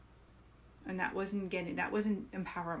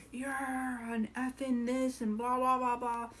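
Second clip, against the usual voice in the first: mock outburst; 5 semitones higher, slower than usual. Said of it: "maybe being like".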